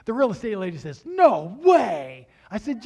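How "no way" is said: amazed. Retold or imitated. Realtor amazed at no appraisal.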